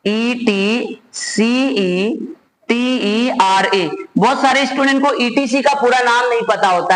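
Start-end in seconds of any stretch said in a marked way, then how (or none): 0.1-3.9 slow, letter by letter; spelling out. Shown as a demonstration. Teacher reminding students what ETC stands for, spelled out slowly.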